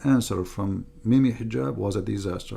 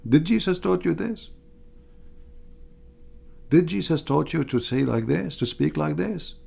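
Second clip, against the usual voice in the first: higher, whiny mock plea, rising on each question; hammed-up outraged whine, much higher; mocking pleading whine; 6 semitones higher than usual. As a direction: parody a scandalised questioner pleading in a high voice. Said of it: "They say".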